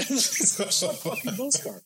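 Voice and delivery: high-pitched, drawn-out incredulity with laughter; mock disbelief. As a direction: act out shock at absurd news. Retold shocked reaction, laughing.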